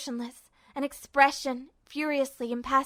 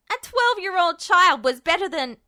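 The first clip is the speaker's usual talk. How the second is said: loud and higher, cut off; Ed's defiant shout; 4 semitones higher, 6 dB louder than usual. Acted. Ed lunges shouting at Justin, loud. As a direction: yell it as he lunges.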